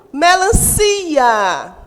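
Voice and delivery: "melancia" slow, with a long, strong CI; stress on CI. Shown as a demonstration. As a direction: stretch and punch the CI in "melancia". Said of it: "o si é a sílaba mais forte". ("melancia" com o CI forte e alongado)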